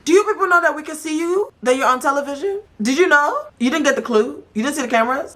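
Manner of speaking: high-pitched, mocking disbelief; incredulous call-out. Real, not acted. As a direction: fire questions at them, voice way up. Host calling out cast on TV.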